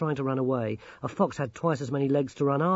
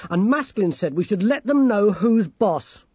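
higher, louder, assertive character voice; defiant; 7 semitones higher, 6 dB louder than usual. Acted. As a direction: argue it forcefully, pitched up. Grimmer defiantly argues in story.

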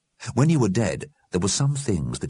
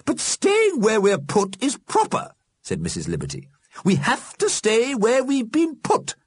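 prim Missus Liberty; 3 dB louder than usual. A narrator's reading. Missus Liberty lectures the dead on propriety, insistent. Old lady insists on rules.

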